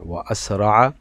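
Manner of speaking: ordinary talk, nothing marked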